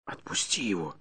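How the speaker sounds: hushed plea into the ear; soft, secretive urging; whispered. A narrator's reading. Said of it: "шепнул я на ухо Бирюку". (шёпотом на ухо)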